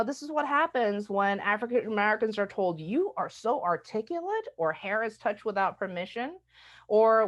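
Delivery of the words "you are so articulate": higher, emphatic mock admiration; amazed compliment. Stranger complimenting African Americans, mock-amazed. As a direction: marvel at it, patronizingly.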